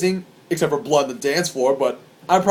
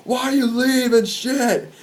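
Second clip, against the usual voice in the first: high-pitched, protesting wail; complaining fans; 7 semitones higher than usual. Retold or imitated. Fans to the band bolting offstage, aggrieved protest. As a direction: imitate upset fans whining at the band. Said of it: "fans just go like".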